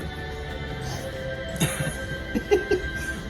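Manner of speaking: squeaky airy laugh; high breathless giggle. Real, not acted. Laughing after the glove blew apart.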